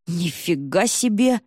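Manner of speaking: slow, impressed exclamation; восхищение. A narrator's reading. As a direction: draw it out in admiration. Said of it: "восхитилась биатлонистка". (протяжно, с восхищением)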